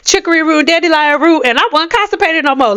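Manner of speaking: lightly voiced satisfied customer; mock customer review. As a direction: play a happy customer. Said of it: "even if it's like".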